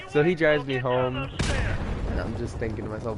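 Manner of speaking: ordinary talk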